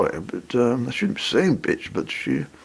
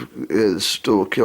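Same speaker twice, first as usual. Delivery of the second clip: normal talking voice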